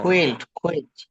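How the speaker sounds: "কুইল্ট": kw glide into a short i, then lt; quilt said correctly. Shown as a demonstration. Teacher corrects the student's quilt: "কুইল্ট". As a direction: say "কুইল্ট" with kwi, not kwai. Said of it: "কোয়েল্ট".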